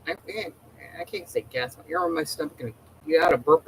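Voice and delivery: ordinary talk, nothing marked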